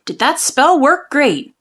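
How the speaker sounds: usual speaking voice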